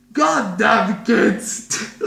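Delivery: put-on loud furious yell at the kids; angry shout. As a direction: bellow it like an angry teacher. Teacher bursting in, yelling.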